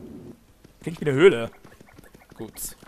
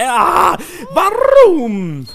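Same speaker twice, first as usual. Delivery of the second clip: laut und hoch losschreien; verzweifelter Aufschrei; 11 semitones higher, 12 dB louder, much slower than usual. Real, not acted. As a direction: schrei deinen Frust lang gezogen heraus. Frustrated at misreading again. (gequälter Schrei über den eigenen Lesefehler)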